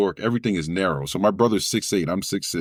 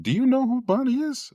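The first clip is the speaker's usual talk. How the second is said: front desk voice. Retold or imitated.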